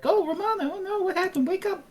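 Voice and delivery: high, fretful voice calling her awake; high-pitched worried plea to wake up; Della's worried call. Retold or imitated. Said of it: "Della comes in and is like".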